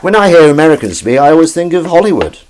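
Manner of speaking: high, loud posh English voice; exaggerated posh gushing; played-up posh. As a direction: lay the posh accent on thick. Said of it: "I played up a bit".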